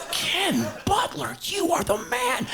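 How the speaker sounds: high, slow, drawn-out hero worship; awed praise. Retold or imitated. To Ken after his catch, high slow awed praise. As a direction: hail him like a champion, stretched out. Praising the drum tech in awe.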